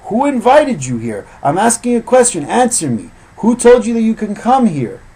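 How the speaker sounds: loud, high, furious demand; shouting down the son-in-law, demanding an answer; angry rebuke. Retold or imitated. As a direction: hammer the son-in-law with questions. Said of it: "and he says".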